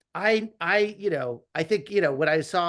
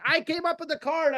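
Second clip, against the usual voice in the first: bursting with pride, pitched way up; excited; 11 semitones higher than usual. Retold or imitated. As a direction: squeal out the proud news of the card. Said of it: "I was so excited".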